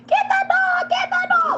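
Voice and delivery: retold yell. Driver yelling from the car at the owner, high frantic yell. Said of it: "I'm rolling out the window like".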